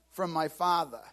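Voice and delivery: ordinary talk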